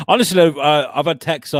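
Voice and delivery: usual speaking voice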